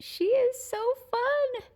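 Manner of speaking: high, loud squeaky pet voice. Real, not acted. Cooing at the kitten.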